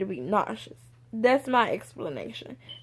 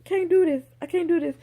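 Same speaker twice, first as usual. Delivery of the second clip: retold crying; 7 semitones higher, faster than usual. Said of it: "I'm all outside crying".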